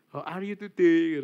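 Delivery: slow, loud rote school greeting; mock classroom recital; imitated classroom greeting. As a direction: recite it like a drilled English lesson. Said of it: "kalau bahasa Inggris tuh gini".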